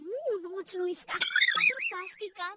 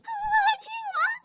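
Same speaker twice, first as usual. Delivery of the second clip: high, slow, louder crowing; gleeful; 11 semitones higher, 5 dB louder, slower than usual. Acted. Daughter-in-law discovers her new power, gleeful. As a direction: crow it with drawn-out delight.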